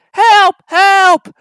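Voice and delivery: very high, loud cries of help; cry for help. A narrator's reading. James rushing through, crying for help. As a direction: scream for help. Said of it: "heard an engine whistling".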